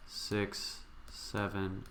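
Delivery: ordinary talk, nothing marked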